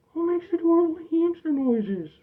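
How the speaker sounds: high sing-song coo to a hamster. Real, not acted. Owner cooing to his hamster in a high voice.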